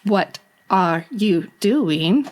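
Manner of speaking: slow, evenly spaced robot words; creepy computer voice. Pretend. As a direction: creep out a friend like a talking machine. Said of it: "you sound like some kind of".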